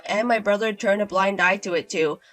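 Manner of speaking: usual speaking voice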